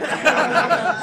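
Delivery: loud high burst of laughter. Real, not acted. Teller cracking up at his own story.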